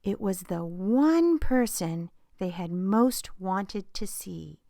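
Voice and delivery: ordinary delivery, nothing marked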